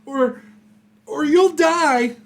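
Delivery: high, loud villain voice; high-pitched bandit ultimatum, stretched at the end; last enemy's warning. Acted. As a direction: threaten desperately in a high put-on shout. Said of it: "You hear the last guy saying".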